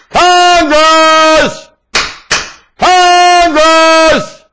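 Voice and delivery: high, loud long call across a hall; calling from far away. Acted. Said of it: "I'm gonna shout over here".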